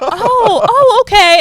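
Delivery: high, loud "oh, okay"; exaggerated, high-pitched polite comprehension; mock realization. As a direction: act out strangers suddenly catching on. Acts out people finally understanding.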